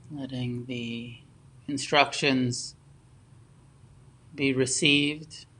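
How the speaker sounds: unhurried meditation guidance with long pauses between phrases; guided meditation. Real, not acted. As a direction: guide them gently into stillness, phrase by phrase.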